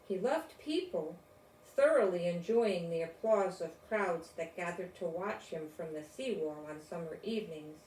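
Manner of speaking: ordinary delivery, nothing marked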